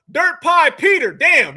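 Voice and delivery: ordinary talk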